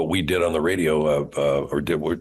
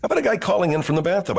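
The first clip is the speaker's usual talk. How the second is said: mock caller voice; 10 semitones higher, faster than usual. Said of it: "I thought".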